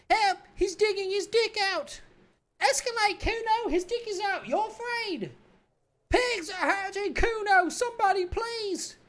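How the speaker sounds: put-on very high, loud screaming kid; child shouting for help. Child shouting for help at the windows, screaming. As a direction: scream to the whole block. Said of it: "It's full blast now".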